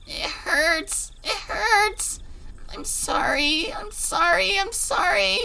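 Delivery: high tearful sobbing; crying in pain. Acted. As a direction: sob out the apology.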